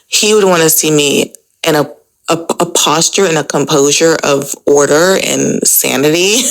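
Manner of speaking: normal talking voice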